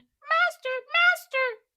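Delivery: very high, loud shrieking cry; screaming harp. Acted. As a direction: shriek for your master. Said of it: "it screamed".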